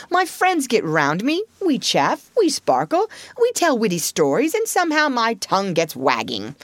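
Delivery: swooping, self-satisfied showing off; Toad, pleased with himself. Acted. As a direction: preen as you talk, pitch riding high. Figure of boastful Toad.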